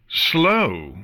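sharp shouted question; shouting. Acted. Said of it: "Chrysalis shouted".

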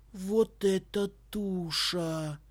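very slow, amazed exclamation; drawn out in awe; awed. A narrator's reading. Boy amazed at airship. (изумлённо, медленно)

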